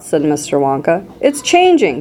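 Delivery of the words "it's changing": mild shout, pitched high; excited shout. A narrator's reading. Violet announces the gum is changing, excited mild shout. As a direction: call it out high without much volume. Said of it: "shouted Violet".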